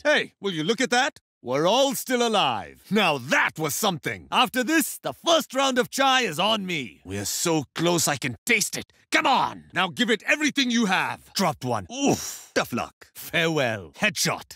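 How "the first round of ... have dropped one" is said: ordinary delivery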